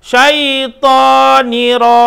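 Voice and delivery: "Syaitoniro" drawn out slowly; slow recitation. Shown as a demonstration.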